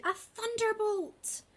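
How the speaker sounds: put-on an old lady amazed at what fell from the sky; startled exclamation. A narrator's reading. Old lady marvels at the falling owl, high and slow. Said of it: "cried the old lady".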